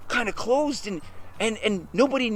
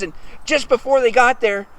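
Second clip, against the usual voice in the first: ordinary delivery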